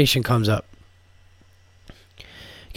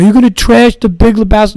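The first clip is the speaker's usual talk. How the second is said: high, loud, outraged fan voice; indignant fan; 8 semitones higher, 7 dB louder than usual. Retold or imitated. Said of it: "I know some of you are like".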